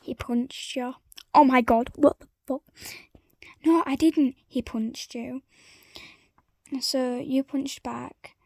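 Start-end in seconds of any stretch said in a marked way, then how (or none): 1.3-2.6 shocked yell. Acted. Punched in the face. 3.6-4.4 loud denial. Acted. Heated denial.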